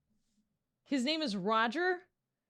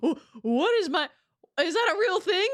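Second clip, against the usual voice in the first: high, louder, laughing through the question; baffled laughter; 7 semitones higher, 4 dB louder than usual. Real, not acted. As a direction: ask it cracking up in disbelief. Baffled by the term wok hei.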